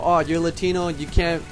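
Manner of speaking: ordinary delivery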